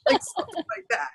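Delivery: laughing through the words, quick. Real, not acted. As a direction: giggle it out at the joke. Laughs at own joke.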